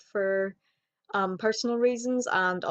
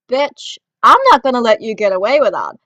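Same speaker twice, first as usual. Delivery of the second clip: loud and indignant; a sudden loud blast of anger; loud angry outburst; 3 semitones higher, 12 dB louder than usual. Real, not acted. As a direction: shout it out, furious. Angry at overheard insult.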